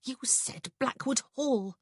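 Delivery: high, slow, tense reproach; mildly hissed; hissed accusation. A narrator's reading. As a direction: accuse him urgently, word by word. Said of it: "she hissed".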